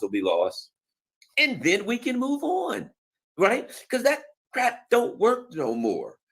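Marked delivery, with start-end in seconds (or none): 1.4-6.2 high, slow, sneering voice; mock sing-song. Mocking the US plan to listeners, high and slow sneer.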